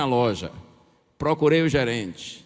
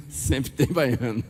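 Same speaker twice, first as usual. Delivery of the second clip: laughing; 4 semitones lower, slower than usual. Real, not acted.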